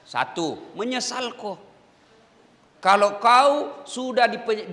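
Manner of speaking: ordinary delivery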